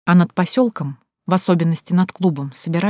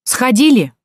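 loud, higher barked question; 7 semitones higher, 7 dB louder, slower than usual. A narrator's reading. Watchman snaps at the girls by the toilet, barking. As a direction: bark it sharply at them. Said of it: "рявкнул он".

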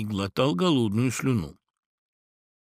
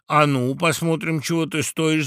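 raised, louder taunt; shouted challenge; 5 semitones higher, 5 dB louder than usual. A narrator's reading. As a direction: dare him like an eager crowd. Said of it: "закричали опять птицы".

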